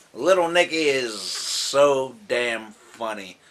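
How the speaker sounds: ordinary talk, nothing marked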